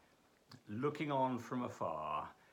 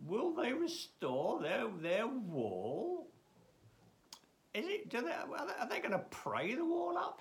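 mocking Sanballat; 7 semitones higher than usual. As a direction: scoff at the builders as if it's laughable. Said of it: "just ridiculing what they're doing".

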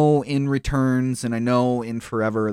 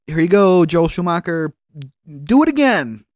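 mock studio exec; 8 semitones higher, 5 dB louder than usual. Mocking studio execs voice.

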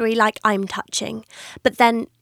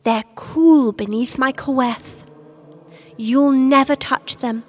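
ordinary talk, nothing marked

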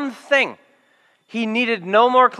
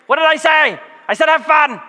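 shouted high, much higher and louder; exasperated shout; 8 semitones higher, 4 dB louder than usual. Retold or imitated. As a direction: blow up at the kid, comically. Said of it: "you just gotta start yelling".

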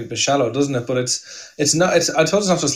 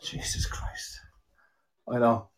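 whispered exasperation; muttered under breath; 10 dB quieter, slower than usual. Real, not acted. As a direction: breathe it out, fed up. Exasperated after the interruption.

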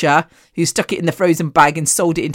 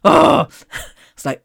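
ordinary talk, nothing marked

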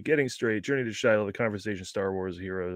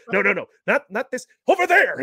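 high, loud panicked direction; frantic shout; 10 semitones higher, 6 dB louder than usual. Retold or imitated. Hero yelling directions at Willie, frantic. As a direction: yell the correction, pointing. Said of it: "And he's like".

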